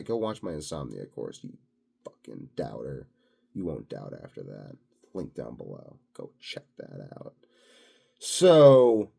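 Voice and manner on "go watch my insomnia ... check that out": sudden low, much softer throwaway plug; hushed aside. Real, not acted. To rich doubters watching, a hushed muttered aside. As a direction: drop the voice to slip the insult in under the breath.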